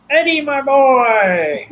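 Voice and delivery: high, drawn-out glad cry of welcome; joyful greeting. Retold or imitated. Granddad greeting his grandson on sight, joyful greeting. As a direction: hail a beloved grandchild, voice high and stretched.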